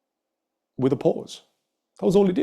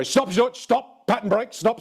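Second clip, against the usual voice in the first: much higher, insistent repeated stop; firm stop; 9 semitones higher, slower than usual. Retold or imitated. Coach halting a student's angry spiral, insistent and high. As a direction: cut him off to break the spiral. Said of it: "I kinda had to go".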